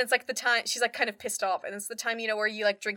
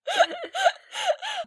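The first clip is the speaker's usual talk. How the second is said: high cackling laugh; real laugh at her own story. Real, not acted. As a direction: burst out laughing.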